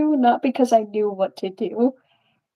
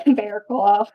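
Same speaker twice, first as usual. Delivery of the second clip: normal talking voice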